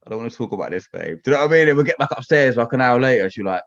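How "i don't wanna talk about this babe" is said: whiny mumble. Retold or imitated. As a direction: groan it out reluctantly. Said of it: "I'm like".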